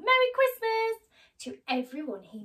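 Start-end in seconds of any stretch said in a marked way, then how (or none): 0.0-1.0 high, loud greeting; calling out. Acted. Scrooge greeting everyone on the street, high and loud. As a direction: call it down the street.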